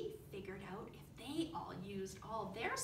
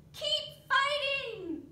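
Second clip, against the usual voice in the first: high loud slow call; crying out; 12 dB louder, much slower than usual. A narrator's reading. Dilly crying out to the fighters, high and loud. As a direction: urge them on. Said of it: "cried Dilly".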